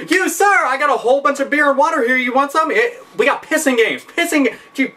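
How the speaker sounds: high, eager salesman call; high eager pitch. Retold or imitated. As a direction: lure them in, high and eager. Rep calls to new customer.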